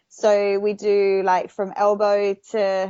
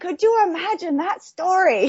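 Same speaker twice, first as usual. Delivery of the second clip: higher, gleeful mock reply; thrilled reply; 10 semitones higher than usual. Stuntwoman answers the shark worry with mock glee. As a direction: relish the idea. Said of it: "I'm like".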